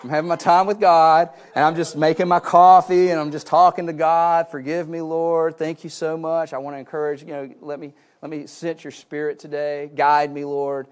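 calling out over the dogs, then praying on; loud retold prayer.

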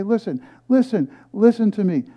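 high, loud prophetic call; high, loud scripture proclamation, slowing on listen; preaching at full force. Acted. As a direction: thunder the prophet's plea. Repeats the call.

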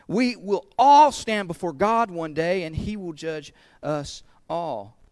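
ordinary talk, nothing marked

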